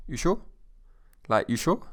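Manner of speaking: short, rising "you sure?"; teasing little challenge; goading. Shown as a demonstration. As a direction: nudge a mate into it. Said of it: "listen to my tone of voice".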